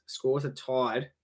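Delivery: ordinary delivery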